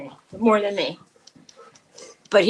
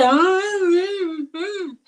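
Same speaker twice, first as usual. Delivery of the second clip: high whining "ah, mm, mm"; loud mock dog whine; imitating dog moan; 8 semitones higher, 5 dB louder, much slower than usual. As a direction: whimper like the dog. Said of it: "he'll say".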